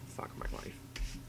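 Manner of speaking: whispered under the breath; defeated whisper; whispered lament. Real, not acted. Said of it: "muttered lament".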